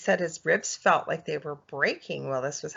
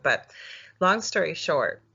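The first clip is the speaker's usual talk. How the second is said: ordinary talk, nothing marked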